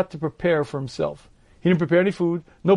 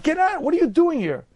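much higher, altered mock scolding; a strained, high-pitched pretend tirade; scolding intruder; 10 semitones higher than usual. Scolding a thought that returned.

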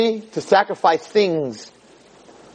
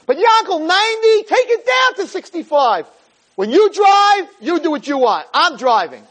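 much higher, pleading then sharp wife voice; nagging wife; 9 semitones higher than usual. Retold or imitated. Wife scolding husband about his speeding, high pleading voice. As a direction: scold him like the wife in the passenger seat. Wife keeps arguing with driver.

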